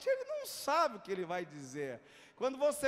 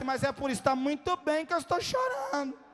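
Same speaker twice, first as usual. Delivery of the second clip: higher, insistent mimicked answer; emphatic retold reply; 5 semitones higher, 7 dB louder than usual. Attendant echoing the preacher's own words, high and emphatic. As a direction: push it out high, as a punchline.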